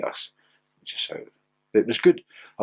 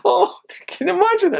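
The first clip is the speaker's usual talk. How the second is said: laughing; 4 dB louder than usual. Real, not acted. Laughing at his own story.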